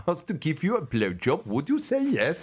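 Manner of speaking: high put-on accent, deadpan question; put-on interviewer. Interviewer character asking the guest, high put-on accent. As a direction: ask it straight in a foreign voice. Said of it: "If President Trump".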